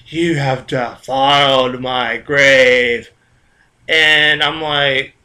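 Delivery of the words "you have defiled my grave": slow, lower, drawn-out spectral voice; ghost woman. Acted. Ghost to the dreamer who disturbed her bones, slow spooky accusation. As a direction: intone it like an accusing spirit. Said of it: "the ghost woman co-, pops out and says".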